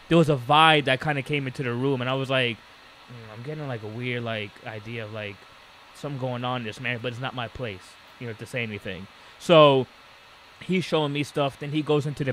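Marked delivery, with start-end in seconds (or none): 2.6-9.1 quiet, lower, held back; hushed inner thought. Retold or imitated. Worker privately sensing trouble in the couple's house, hushed. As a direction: think it under your breath, uneasily.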